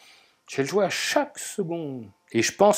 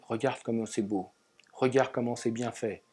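soft, lowered voice of quiet wonder; small inner voice; 4 semitones lower, 6 dB quieter than usual. Acted. Inner voice pointing out beauty to oneself, softly. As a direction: murmur it like a gentle inner prompting. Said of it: "une petite voix qui dit".

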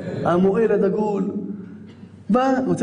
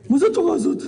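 mock outrage; 8 semitones higher, faster than usual. Mocks the critic, then answers.

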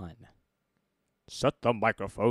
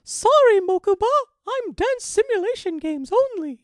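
very high, loud character voice; put-on squeal; 11 dB louder than usual. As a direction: shriek it in falsetto. Acted line in put-on voice.

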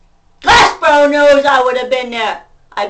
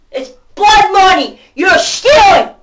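shouting accusation, enraged; enraged yell at the thieves; furious shout; 6 semitones higher, 6 dB louder than usual. Real, not acted. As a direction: yell it in real fury. Angry rant at people.

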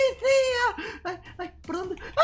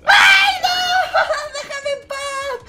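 high scream dissolving into laughter; playful panicked yell; frightened shout; 8 semitones higher, 9 dB louder, slower than usual. Acted. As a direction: yell at the monster to go away. Chased by the plush monster.

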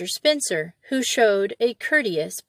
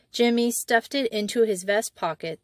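plain reading voice

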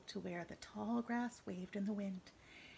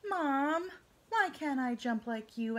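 tadpole voice; 4 semitones higher, 5 dB louder, slower than usual. Acted. Tadpole pleads with mother.

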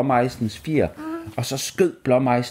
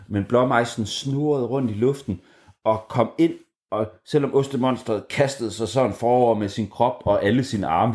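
ordinary delivery

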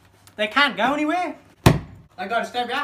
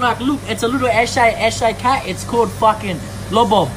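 eshay slang; 8 dB louder than usual. Acted. Loud eshay showing stolen cat.

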